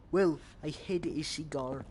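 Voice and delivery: ordinary delivery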